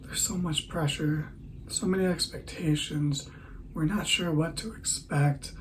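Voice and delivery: a tongue-in-cheek documentary hush; mock dramatic hush. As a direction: build suspense like a hammed-up trailer. Mock dramatic build-up intro.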